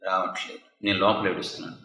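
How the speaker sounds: very soft, low mock excuse; acted tearless grief. Dry-eyed son excusing himself to his friend, acted low and quiet. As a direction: murmur it low, as if unable to cry.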